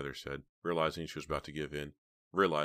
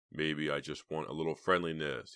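ordinary delivery

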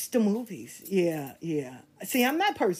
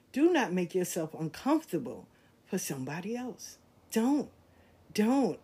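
sincere advice; 7 semitones lower, 5 dB quieter than usual. Acted. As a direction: speak this from the heart.

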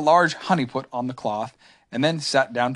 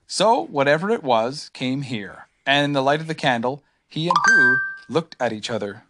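ordinary delivery

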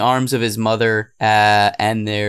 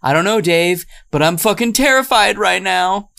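mock terrified; 9 semitones higher than usual. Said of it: "the older sister is saying".

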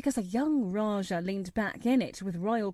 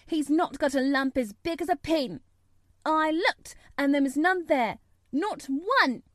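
put-on high, loud, indignant girl; almost shout it, pitched high; fierce indignation; 6 semitones higher, 4 dB louder than usual. A narrator's reading. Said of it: "almost shouting up the wall in her fierce indignation".

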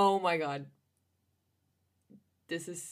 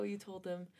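ordinary delivery

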